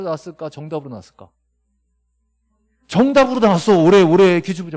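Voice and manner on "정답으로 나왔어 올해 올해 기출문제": much louder and higher insistence; 목소리를 확 높여 강조하기; emphatic shout. Real, not acted. Teacher hammering home an exam point. (학생들에게 기출을 강조하는 큰 외침)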